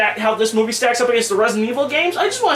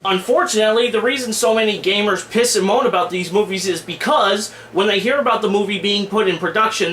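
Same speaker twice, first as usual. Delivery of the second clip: ordinary talk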